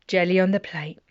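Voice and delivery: quick breathy gasp of delight; excited whisper. Real, not acted. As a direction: whisper it like a treasure found. Gasps, delighted at finding rhyme.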